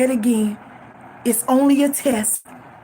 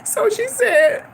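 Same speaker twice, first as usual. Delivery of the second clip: high, slow, tearful start; real crying; 9 semitones higher, slower than usual. Real, not acted. Daughter retelling what mama said, starting to cry. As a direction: choke up as you begin. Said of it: "always have the ability to make me cry".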